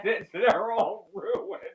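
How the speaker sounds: mourning ruined new shoes to the husband, mock wailing. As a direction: whine it like a tragedy. Mock lament over new shoes.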